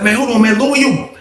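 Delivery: imitated irritated interruption, not shouted; snapping. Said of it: "he snapped".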